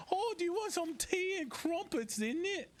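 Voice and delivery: hammed-up high British voice; British impression. Offering tea and crumpets in a high mock British accent. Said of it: "my British impression".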